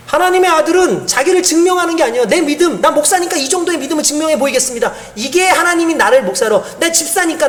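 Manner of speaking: climbing, fervent sermon voice; impassioned preaching. Acted. Pastor urges believers to trust God fully, fervent. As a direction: build toward the altar call.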